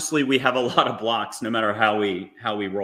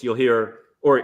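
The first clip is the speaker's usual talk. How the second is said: normal talking voice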